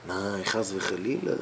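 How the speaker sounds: ordinary delivery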